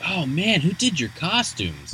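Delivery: lightly voiced excited partygoer; mock excited girl. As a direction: gush over the costumes like a teen girl. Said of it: "is like".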